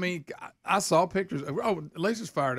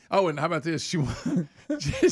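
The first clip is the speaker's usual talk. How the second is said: ordinary talk, nothing marked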